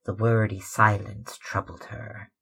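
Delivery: ordinary delivery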